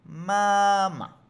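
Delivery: "Mamá" long high first syllable, short second; loud held MA, quick dropped "ma"; neutral second tone. Shown as a demonstration. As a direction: hold the first half of "Mamá", clip the last. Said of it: "Ese es el tono neutro".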